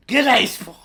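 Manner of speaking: mock Irish that turns Australian; Irish accent gone Australian again; fake Irish accent. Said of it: "what's your Irish accent?".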